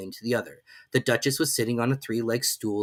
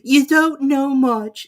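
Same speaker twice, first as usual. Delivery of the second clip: high, slow, imperious lady; grand and dismissive; Duchess; 10 dB louder, much slower than usual. A narrator's reading. Duchess figure voice.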